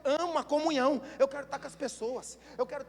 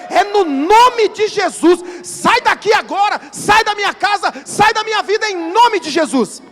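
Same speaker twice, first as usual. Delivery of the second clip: much higher and louder, slow commanding cast-out; shouted rebuke; 8 semitones higher, 18 dB louder, slower than usual. Shown as a demonstration. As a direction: drive the devil out at full voice. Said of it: "vai falar assim".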